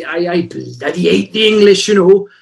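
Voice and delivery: a light Scottish accent, imitating the local farmer. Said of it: "Did you pull out the Scottish accent?".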